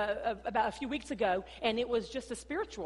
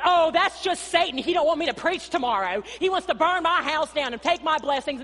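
mock preacher outrage; 5 semitones higher, 9 dB louder than usual. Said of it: "We're going".

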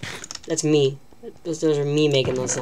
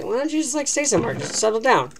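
mock viewer; 6 semitones higher than usual. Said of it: "before you say".

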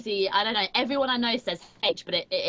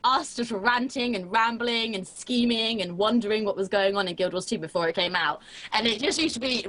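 normal talking voice

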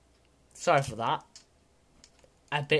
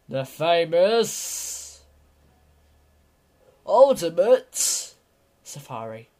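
announcer build-up; 5 dB louder than usual. Acted. Grand unveiling of the game.

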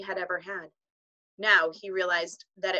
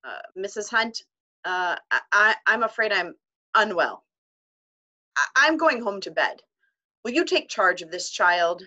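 loud, flustered headmaster stammering; croaked; 8 dB louder than usual. A narrator's reading. Headmaster to teacher, a loud croaking stammer. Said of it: "At last he croaked".

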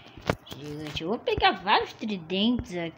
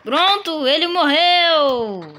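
loud, higher, slow victory cry; triumphant shout; 8 semitones higher, 9 dB louder, much slower than usual. Real, not acted. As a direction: celebrate the win. Boss dies. (grito de vitória)

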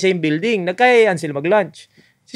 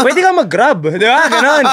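higher, louder show-off tito voice; smug bragging uncle; 8 semitones higher, 6 dB louder than usual. Retold or imitated. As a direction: brag like a condescending uncle. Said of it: "Tito Mong Mayabang". (mayabang na tito, mataas at malakas)

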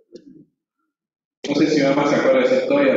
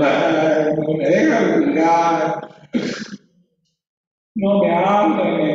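put-on slow, drawn-out child's wail; imitation of a crying kid complaining; mock crying; 3 semitones higher, much slower than usual. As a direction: whine it out like a tearful child.

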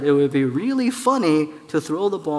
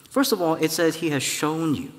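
ordinary talk, nothing marked

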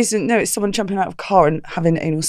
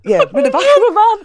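high, loud laughing; 13 semitones higher, 5 dB louder than usual. Real, not acted. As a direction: burst into laughter. Telling shocking anecdote.

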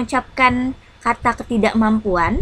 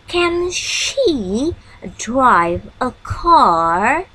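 slow rising model question; much slower than usual. Shown as a demonstration. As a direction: repeat the question slowly for learners. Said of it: "Seperti ini contohnya".

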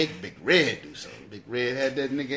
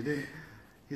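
usual speaking voice

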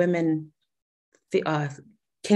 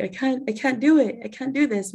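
upset fisherman; 6 semitones higher than usual. Retold or imitated. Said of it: "And he's like".

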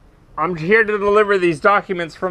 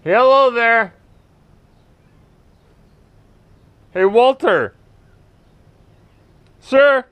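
louder raised call; 4 semitones higher, 3 dB louder than usual. Real, not acted. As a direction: call out for the owner. Calling out at a house.

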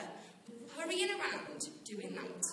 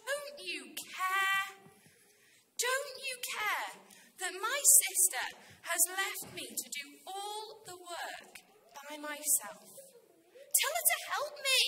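exasperated; 3 semitones higher than usual. Acted. Martha appeals to Jesus about her sister, exasperated. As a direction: complain indignantly, then burst out at the end. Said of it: "She gets more and more distracted".

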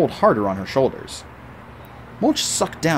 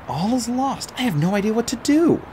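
higher, hopeless lament; despairing; 11 semitones higher than usual. Acted. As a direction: give up aloud. Artemis despairing.

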